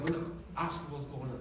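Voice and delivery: low-key, soft mock aside; hushed aside. Nosy woman to her neighbour about a crying stranger, hushed. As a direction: nudge someone quietly, as if retelling an overheard aside.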